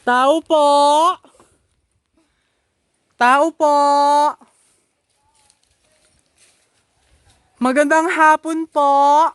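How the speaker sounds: loud high call toward the house; calling from far away. Real, not acted. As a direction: call out loudly to whoever's inside. Calling out at farm gate.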